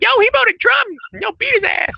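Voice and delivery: very high, loud mock yell; hammed-up high-pitched shouting of an excited attacker; mocking impersonation. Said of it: "the racist impersonation".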